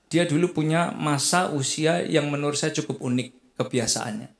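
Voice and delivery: usual speaking voice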